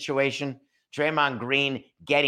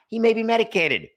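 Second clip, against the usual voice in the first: mock aside; 10 semitones higher, 5 dB louder than usual. Acted Silver aside.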